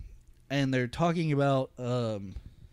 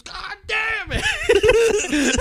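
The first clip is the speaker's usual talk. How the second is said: high, loud, drawn-out outburst; angry mock yell; 7 dB louder, much slower than usual. Movie gangster raging at a lost bet, hammed-up angry yell. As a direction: imitate an enraged sports fan's long yell. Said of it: "he just gets mad at games".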